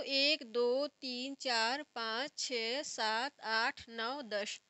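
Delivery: drawn-out count, each number held; slow counting. Shown as a demonstration. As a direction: count very slowly for a class, dropping on the last. Teacher recites the count for learners.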